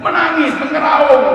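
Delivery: sudden high, loud outcry on each word; preacher's high, loud wailing burst; wailing cry. Acted. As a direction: cry the words out like a wail. Preacher dramatises the imam's wailing.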